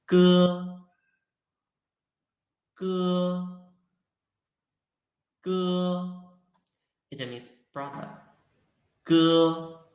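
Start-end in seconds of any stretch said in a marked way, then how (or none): 0.1-6.4 "哥" drawn out high and level; first tone. Shown as a demonstration. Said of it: "this is the first tone". 9.0-9.8 "哥" drawn out high and level; first tone. Shown as a demonstration. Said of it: "this is the first tone".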